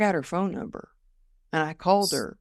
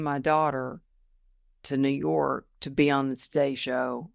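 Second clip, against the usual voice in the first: ordinary delivery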